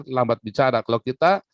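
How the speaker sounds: usual speaking voice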